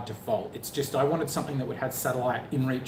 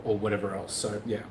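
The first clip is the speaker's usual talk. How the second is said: ordinary delivery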